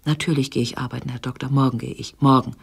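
ordinary delivery

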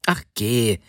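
mildly miffed brush-off; slightly offended. A narrator's reading. Wife reacts to husband's sour guess, slightly offended. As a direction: say it a little hurt. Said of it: "meinte die Frau etwas beleidigt".

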